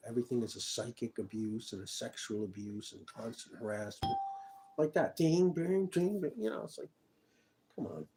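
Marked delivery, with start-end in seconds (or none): 5.2-6.3 imitated ding noises.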